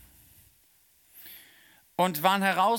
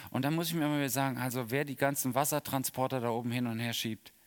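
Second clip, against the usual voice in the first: usual speaking voice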